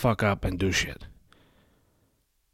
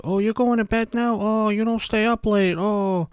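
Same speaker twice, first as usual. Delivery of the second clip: much higher, sing-song teasing voice; mocking laugh-at-you voice; 12 semitones higher, 3 dB louder than usual. Night owls teasing the early riser, high mocking. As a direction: sneer at the early sleeper. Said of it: "They'll laugh at you".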